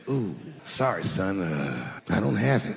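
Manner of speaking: low, soft, apologetic dad voice; sheepish dad. Retold or imitated. Broke dad apologizing to his son, low and soft. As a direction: mumble it like a broke father.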